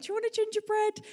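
high-pitched, tentative doorstep offer; retold awkward, high-pitched question.

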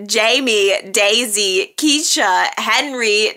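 normal talking voice

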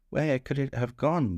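a hint of buzzing worry; buzzing bees. A narrator's reading. The bees fret about the missing honey, mildly buzzing. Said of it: "buzzed the bees".

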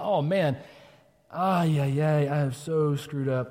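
drawn-out, groaning "oh man"; slow, dragged-out lament of someone who messed up; groaning regret. Acted. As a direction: groan it in mock dismay. Imagined groan over one's failure.

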